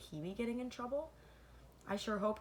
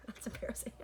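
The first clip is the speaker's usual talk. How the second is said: embarrassed laugh; 3 semitones higher, 4 dB quieter, faster than usual. Real, not acted. Teacher laughs at own slip.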